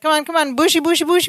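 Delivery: high, excited Balki imitation. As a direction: call out bushy, bushy, high. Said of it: "And he's like".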